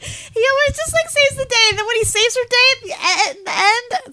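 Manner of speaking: high, laughing, choked up; teary laughing. Real, not acted. Moved by the little dog, laughing and teary. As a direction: laugh through happy tears. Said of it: "She's crying now".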